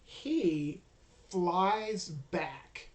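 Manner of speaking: ordinary delivery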